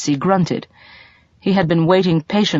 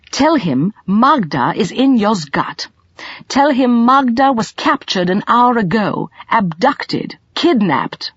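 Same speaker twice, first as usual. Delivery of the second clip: lightly raised, slow urgent pleading to the interpreter; urgent young man; 6 semitones higher, slower than usual. A narrator's reading. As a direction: press each sentence out for translation. Colin urges through interpreter.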